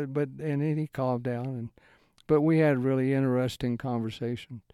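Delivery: ordinary talk, nothing marked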